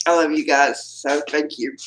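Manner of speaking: low, tearful gratitude; emotional. Real, not acted. Host thanks supporters of her channel, emotional. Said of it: "Misty had an emotional breakdown".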